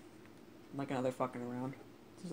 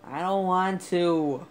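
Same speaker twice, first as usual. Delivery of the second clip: whiny; 5 semitones higher, 9 dB louder, much slower than usual. Acted. Whining at a scary game level.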